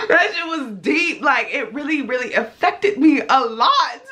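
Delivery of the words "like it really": usual speaking voice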